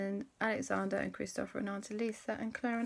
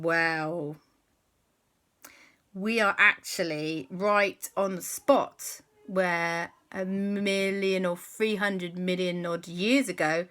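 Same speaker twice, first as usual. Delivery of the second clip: slow spooky telling; 4 dB louder, slower than usual. Acted. Frog tells ghost story.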